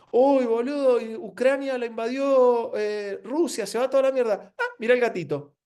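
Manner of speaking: high, excited mock voice jumping between news; mock scroller voice. Imitating someone doomscrolling from war to a kitten: high, excited mock voice. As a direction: ham up a distracted scroller.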